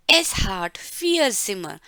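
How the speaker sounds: very slow, word by word; slow model reading. Shown as a demonstration. Teacher rereading the German line slowly for students. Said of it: "repeated model sentence".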